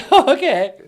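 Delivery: very high, laughing squeaky voice; squeaky wife voice. Acted. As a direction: play the wife as a chirpy cartoon. Wife voice on the line.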